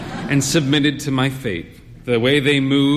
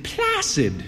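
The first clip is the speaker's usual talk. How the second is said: higher, drily repeated word; mocking echo; 10 semitones higher than usual. Wife scoffing at husband's wording, mocking. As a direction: throw his word back at him. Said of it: "she said".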